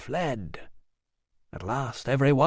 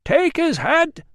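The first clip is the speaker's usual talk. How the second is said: high, loud, slow roar; a voice in the crowd roaring; 5 dB louder, slower than usual. A narrator's reading. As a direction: bellow it from the back. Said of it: "roared a voice".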